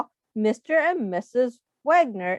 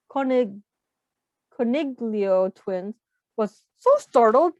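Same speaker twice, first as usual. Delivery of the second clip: usual speaking voice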